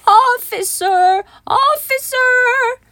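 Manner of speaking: high, drawn-out call for the officer; high-pitched storybook shout. A narrator's reading. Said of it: "shouted Baby".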